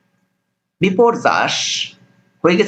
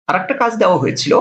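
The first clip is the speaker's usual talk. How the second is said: ordinary talk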